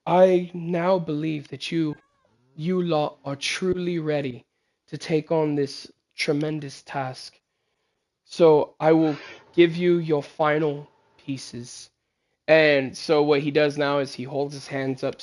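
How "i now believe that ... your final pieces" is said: measured royal character voice; stately king. Acted. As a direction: praise the heroes as their formal king. Voices King Teja granting the final pieces.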